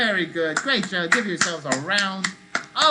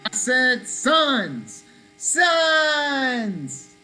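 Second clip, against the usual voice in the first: higher, louder and slow, stretching sons wide; big sunny shout; 5 semitones higher, 4 dB louder, much slower than usual. Shown as a demonstration. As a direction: call it out bright and big to the kids. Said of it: "I'm either gonna shout out sons".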